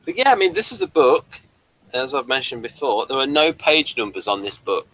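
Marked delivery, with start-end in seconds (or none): none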